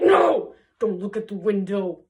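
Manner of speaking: strict guardian barking a loud "no"; hammed-up controlling witch, loud on each "no"; Dame Gothel. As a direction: forbid it sharply in a put-on voice. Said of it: "Dame Gothel's like".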